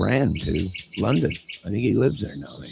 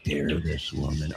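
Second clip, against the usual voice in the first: hushed, low, scandalized gasp of offense; mock outrage; 6 semitones lower, 6 dB quieter than usual. Streamer feigning offense that Debbie Fox insulted Mel Gibson. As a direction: clutch your pearls quietly.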